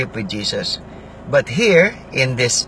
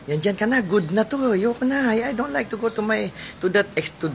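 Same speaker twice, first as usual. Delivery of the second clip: lazy comfort-zone voice; 9 semitones higher, faster than usual. Pretend. Voicing a person stuck in comfort.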